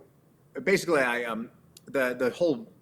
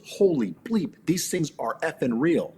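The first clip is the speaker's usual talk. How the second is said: higher, punchy mock astonishment; retold amazement; 4 semitones higher, 4 dB louder than usual. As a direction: imitate a stunned first reaction. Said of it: "first thing she said to me".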